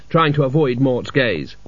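ordinary delivery, nothing marked

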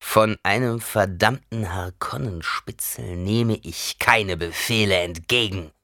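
put-on slow and heavy, syllable by syllable, drunk defiance; slow, every syllable stressed. A narrator's reading. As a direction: drag it out, hitting each syllable. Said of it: "langsam und jede Silbe besonders betonend".

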